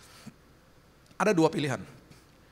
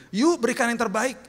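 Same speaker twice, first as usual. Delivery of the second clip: higher-pitched rousing exhortation from the pulpit; high, chanted church appeal; pulpit slogan; 9 semitones higher, 4 dB louder than usual. Retold or imitated. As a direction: mimic a preacher urging the congregation on. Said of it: "didengungkan begini".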